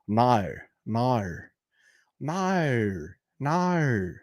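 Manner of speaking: drawn-out Australian "no"; imitating an Australian saying "no"; Australian accent. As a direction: stretch each "no" into an Australian diphthong. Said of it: "That's how she said no".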